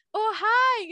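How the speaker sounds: high, loud, imitated hello; bright greeting. French person meeting someone new, imitated eager greeting. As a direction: greet a new acquaintance with showy enthusiasm.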